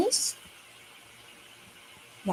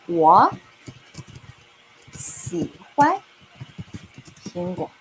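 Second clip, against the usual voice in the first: 我, 喜欢, 苹果 spaced out with long pauses; teacher answering a model question word by word; slower than usual. Shown as a demonstration. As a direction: pause between each word. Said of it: "I'm gonna say".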